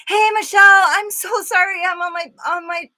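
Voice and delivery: high, loud, gushing apology; excited greeting. Real, not acted. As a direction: squeal hello to a friend who just arrived. Calls out greeting to arriving viewer.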